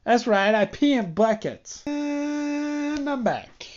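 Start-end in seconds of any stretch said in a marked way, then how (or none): 1.8-3.7 high, drawn-out back from the break; mock radio announcer. Resuming his podcast after a pause, hammed-up host call. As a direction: stretch it like a show host. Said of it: "I did that on purpose".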